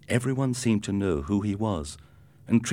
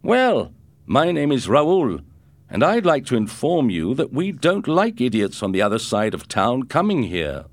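loud, higher mock-polite threat; menacing bully; 6 semitones higher, 6 dB louder than usual. Raoul warns Ricardo off his turf, loud mock-polite threat. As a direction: inform him coldly who's boss.

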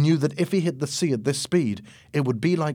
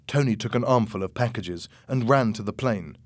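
ordinary delivery, nothing marked

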